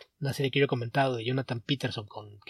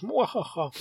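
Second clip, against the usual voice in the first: high mock evil cackle; sneering panto villain; 6 semitones higher, slower than usual. Mock villain laugh about DC. (risa malvada de villano burlón)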